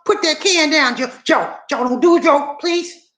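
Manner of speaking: high, begging wife's voice; wife begging husband to put the can down; pleading wife. Retold or imitated. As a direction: plead with Joe, pitch up high. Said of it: "And she just pleaded".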